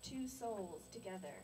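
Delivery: slow, measured poetry recital; deliberate verse with drawn-out line endings; love poem reading. Acted. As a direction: recite a love poem, unhurried, pausing between phrases. Reads love poem for husband.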